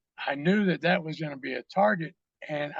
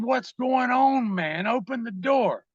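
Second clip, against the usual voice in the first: raised, higher-pitched calling out; insistent call, first words stretched; retold urgent demand; 6 semitones higher, slower than usual. Demanding friend open locked bathroom door, urgent raised voice.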